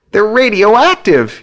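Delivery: high, slow, loud panicked wail. A narrator's reading. Hugh wails at the djinn in pure panic. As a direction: wail it out like the sky is falling. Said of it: "Hugh wails".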